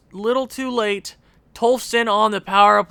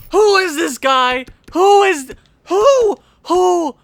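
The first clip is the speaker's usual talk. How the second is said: higher and louder, repeated; baffled shouting; 10 semitones higher, 6 dB louder than usual. Real, not acted. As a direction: demand who scored. Unknown scorer.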